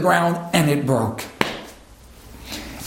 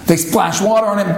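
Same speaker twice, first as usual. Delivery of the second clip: normal talking voice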